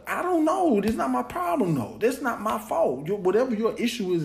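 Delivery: high-pitched, insistent pushback; exasperated retort. Retold or imitated. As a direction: throw the blame back, pitch jumping way up. Acted exasperated reply to friend.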